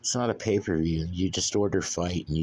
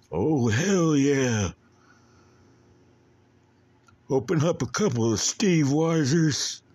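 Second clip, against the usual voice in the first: high, loud attempt at a Texan wrestler; drawn-out, raised-voice Texan wrestler impression; Stone Cold Steve Austin; 7 semitones higher, 4 dB louder than usual. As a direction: holler it slow like a rowdy Texan. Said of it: "I can't do a Stone Cold accent".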